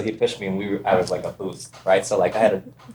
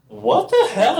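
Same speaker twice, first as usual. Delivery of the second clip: high, startled what the hell; shocked outburst, pitched way up; slower than usual. Retold or imitated. Shocked reaction in her story.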